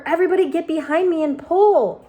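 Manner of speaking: a very high, loud single bark of an order; rally everyone with one word; loud command shout. Acted. Grasshopper ordering the others to haul the string, shouting. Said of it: "shouted the old green grasshopper".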